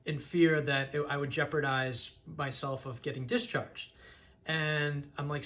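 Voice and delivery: ordinary delivery, nothing marked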